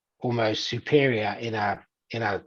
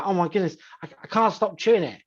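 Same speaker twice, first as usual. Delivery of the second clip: higher, half-joking desperate plea; a gum chewer mockingly begging, lightly pitched-up plea; mock hooked gum chewer; 7 semitones higher than usual. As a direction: play a helpless addict for laughs, pitched up. Mock addict pleading over gum.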